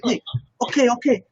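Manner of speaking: high, eager Italian-accented imitation; lightly put-on Italian accent, urging; Gianmarco. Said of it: "He's got this awesome Italian accent".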